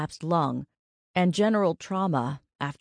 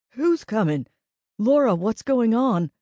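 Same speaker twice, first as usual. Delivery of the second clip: louder, higher, alarmed questioning; urgent, raised voice; 6 semitones higher, 6 dB louder than usual. A narrator's reading. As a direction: demand to know what's wrong. Alarmed man questions sobbing woman.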